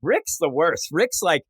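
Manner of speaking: ordinary delivery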